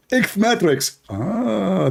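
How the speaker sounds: high squeaky repeat; lampooned rival insisting on his term; mock debater.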